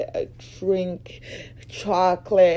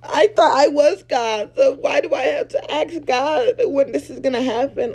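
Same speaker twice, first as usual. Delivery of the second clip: mock confused believer; 6 semitones higher, 6 dB louder than usual.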